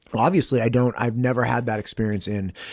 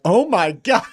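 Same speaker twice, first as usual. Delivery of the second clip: shocked viewer reaction; 8 semitones higher, 4 dB louder than usual. Retold or imitated.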